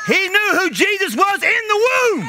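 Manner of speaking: high, full-throated preacher's shout; preaching shout. Real, not acted. Preacher proclaiming the unborn baby knew Jesus, climactic shout. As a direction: cry it out as the sermon's climax.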